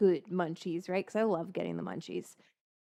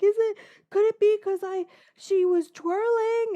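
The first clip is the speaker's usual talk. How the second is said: retold tearful plea; 11 semitones higher, 7 dB louder than usual. Asking the vet if she caused it, tearful. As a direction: beg for an answer through tears. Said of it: "burst into tears this morning".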